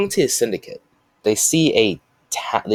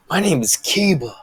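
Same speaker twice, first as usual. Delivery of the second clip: higher, lightly voiced proud Kiba; Kiba's proud answer; 8 semitones higher than usual. Kiba announces his real name to the guards, higher and proud. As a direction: blurt out the real name with pride.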